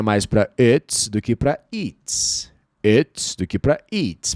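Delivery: it's with a short i, eats with a long ee; it's versus eats. Shown as a demonstration. Teacher contrasting two sounds slowly. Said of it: "o I geralmente tem som de E".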